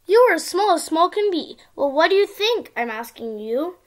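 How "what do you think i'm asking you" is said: ordinary delivery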